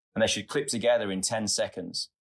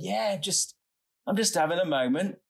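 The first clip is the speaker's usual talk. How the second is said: teary; 9 semitones higher than usual. Retold or imitated. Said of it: "You, you're crying".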